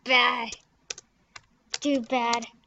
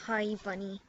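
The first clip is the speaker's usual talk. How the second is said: normal talking voice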